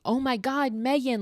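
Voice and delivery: high-pitched mock cry of alarm; high, half-voiced retold scream. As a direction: imitate a scream without really yelling. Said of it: "runs out of the room screaming".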